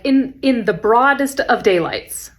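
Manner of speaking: slow, drawn-out indignation; hammed-up teacher outrage; mock outrage. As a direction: stretch it out in theatrical disbelief.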